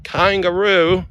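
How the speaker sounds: "Kangaroo" high and stretched, broad first vowel like "kahn"; exaggerated pronunciation. Shown as a demonstration. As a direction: sing out "Kangaroo" slowly in mock Aussie. Trying Australian pronunciations of kangaroo.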